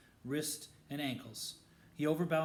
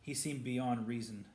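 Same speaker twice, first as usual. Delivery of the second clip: ordinary delivery, nothing marked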